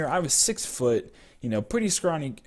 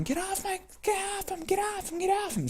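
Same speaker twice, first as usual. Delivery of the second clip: imitated grandma yelling, very high and soft; high-pitched, hushed mock screaming; 7 dB quieter than usual. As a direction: squeal it like a frantic grandma, volume held down. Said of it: "started yelling".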